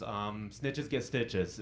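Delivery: slow, low, soft kindergartner threat; a tiny girl threatening slowly and quietly; menacing kid. Retold or imitated. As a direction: kindergartner warning her teacher, low and slow. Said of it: "She goes".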